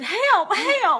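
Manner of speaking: high, loud cry for help; frantic plea. Retold or imitated. Texting her mentor, a mock panicked plea for help. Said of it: "texted my dear friend and mentor".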